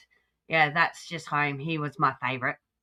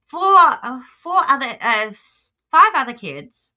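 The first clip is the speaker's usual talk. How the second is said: heated, higher pitch, louder; indignant defence; 6 semitones higher, 5 dB louder than usual. Real, not acted. Defending the new mother against Laura, indignant and raised. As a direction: push back hard on the insult.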